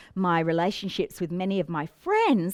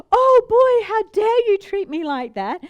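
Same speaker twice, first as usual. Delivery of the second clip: high, loud indignant cry of offence; mock-outraged, pitch leaping up; outraged client; 11 semitones higher, 7 dB louder than usual. Client snapping back at the coach, loud outraged.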